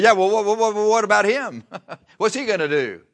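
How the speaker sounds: high, loud stammered objection; flustered protest. Acted. Peter blurting to the Lord about John, flustered protest. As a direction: blurt it out, tripping over the first words.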